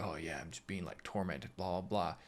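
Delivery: low, soft, throwaway mumble; mock-casual shrug in the voice; offhand dismissal. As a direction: brush it off quietly, trailing into blahs. Acts out typical downplaying reaction.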